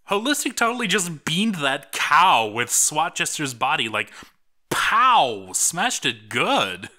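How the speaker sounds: excited. Real, not acted. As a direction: gush about the big hit. Hyped over the carnage shown.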